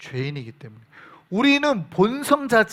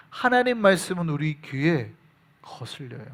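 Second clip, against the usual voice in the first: normal talking voice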